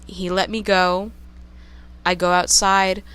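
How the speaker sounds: ordinary talk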